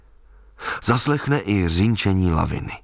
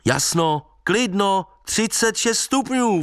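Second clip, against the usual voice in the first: higher and higher, slowing call; calling after; 9 semitones higher, slower than usual. Retold or imitated. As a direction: shout the forecast after the fleeing old man. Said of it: "volal jsem za ním".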